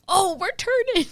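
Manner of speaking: very high, obnoxious squeal of fake thrill; obnoxious mock excitement. Wife teasing husband as plane turns, obnoxious high squeal. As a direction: play up the turn to embarrass someone. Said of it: "like, obnoxious".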